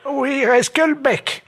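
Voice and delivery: put-on slow, high-pitched old lady; slow squeaky elderly woman. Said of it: "passer le téléphone à la dame".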